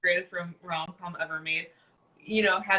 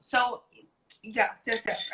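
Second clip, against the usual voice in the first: ordinary delivery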